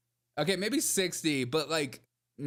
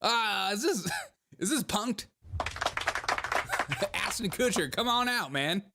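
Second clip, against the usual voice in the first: high, hammed-up panic, higher throughout; mock panic; 6 semitones higher than usual. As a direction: play it like he is being pranked on camera. Mock panic, thinks he's punked.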